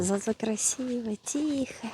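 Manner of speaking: soft hushed whisper. Real, not acted. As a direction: breathe the words quietly. Quiet scenic spot, hushed.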